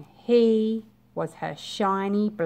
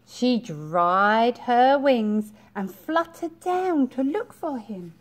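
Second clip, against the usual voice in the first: ordinary delivery, nothing marked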